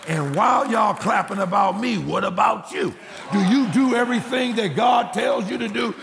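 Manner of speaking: high, impassioned challenge to congregation; fiery sermon exhortation; full-force preaching. Real, not acted.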